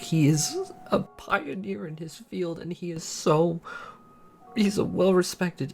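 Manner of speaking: high, tearful and cracking, close to crying; fighting back tears while praising him; real crying voice, words squeezed out high; voice breaking. Real, not acted. Tearful confession about loving boyfriend.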